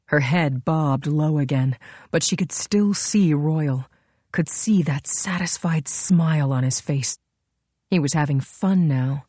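ordinary delivery

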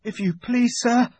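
put-on high, timid frightened boy; scared boy facing a wolf; trembling with fear. A narrator's reading. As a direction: plead politely, barely able to speak. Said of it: "trembling so that he could hardly speak".

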